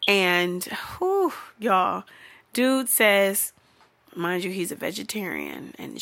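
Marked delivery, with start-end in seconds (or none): none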